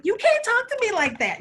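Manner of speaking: high indignant outburst. Retold or imitated. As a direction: protest sharply, voice up high. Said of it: "I was like".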